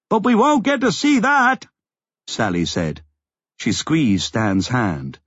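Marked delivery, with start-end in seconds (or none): 0.1-1.7 high, calling over noise; raised voice. A narrator's reading. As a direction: make yourself heard over the din. Sally answers over the roaring quake.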